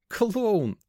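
high-pitched, teasing burst; playful high exclamation; amused outburst. A narrator's reading. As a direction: toss the insult out, half giggling. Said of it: "прыснула она и толкнула меня".

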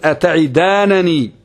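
slow "أتعدانني" ending -nanī; slow recitation, a after the first nun in "أتعدانني"; first nun with fatha. Shown as a demonstration. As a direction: draw out "أتعدانني" as ata'idaananī. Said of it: "يعني بفتحها".

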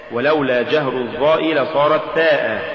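ordinary talk, nothing marked

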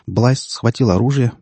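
usual speaking voice

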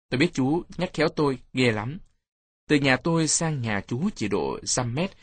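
ordinary delivery

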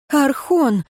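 quick exhaled address; exhaled word. A narrator's reading. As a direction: let the name out on a breath. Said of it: "выдохнула перворождённая".

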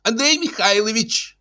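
high female voice, calling from another room; mock shout in a woman's high register. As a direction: call the husband sharply in a high voice. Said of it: "закричал женский голос из комнаты".

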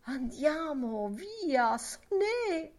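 high, slow urging call. Acted. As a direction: egg her on to ring it. Lenehan urging the bell strike.

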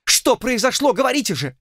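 high, tearful demanding voice; angry crying. A narrator's reading. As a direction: demand it through tears. Esteban demanding answers, distraught.

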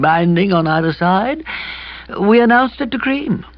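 sheepishly explaining a blunder; gloomy young man voice. A narrator's reading. Kipper explains glumly.

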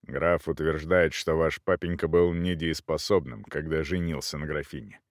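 low, subdued unwilling explanation; reluctant low-voiced admission, dropping away. A narrator's reading. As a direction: explain it grudgingly, pitched low. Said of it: "нехотя объяснил кавалер".

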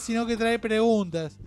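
normal talking voice

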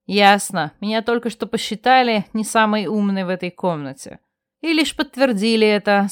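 usual speaking voice

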